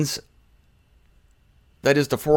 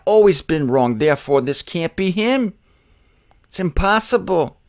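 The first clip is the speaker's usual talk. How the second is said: ordinary talk, nothing marked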